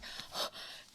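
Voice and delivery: sharp unvoiced gasp of panic; mock gasp. Musical director reacting to the whispered reassurance, mock gasp. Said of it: "And I'm like".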